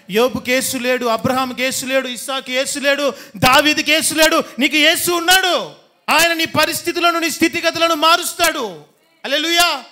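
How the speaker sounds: loud building preaching, pitch climbing; preacher lifting the congregation to a hallelujah; preaching climax. Acted.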